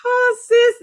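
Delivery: high, loud gasp after laughing; tickled. Real, not acted. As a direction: catch your breath from a big laugh. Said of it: "that tickled me".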